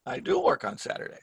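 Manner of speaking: "do" hit high and hard; emphatic do. Shown as a demonstration. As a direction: lean on "do" to correct a mistake. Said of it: "you have to do it with your voice".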